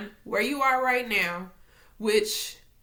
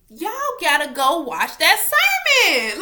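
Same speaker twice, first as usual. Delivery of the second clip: excited urging; 7 semitones higher, 10 dB louder than usual. Real, not acted. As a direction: call it out loudly to the viewers. Urging viewers, sudden outburst.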